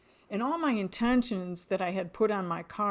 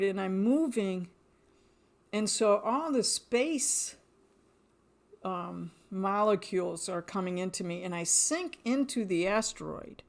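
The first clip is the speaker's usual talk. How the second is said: ordinary talk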